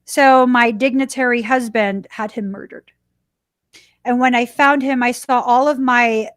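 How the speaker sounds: normal talking voice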